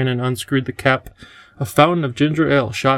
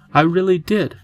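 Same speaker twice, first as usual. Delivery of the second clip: put-on higher, insistent child; protesting cry; 5 semitones higher than usual. A narrator's reading. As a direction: insist like a child wanting belief. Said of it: "cried Tom".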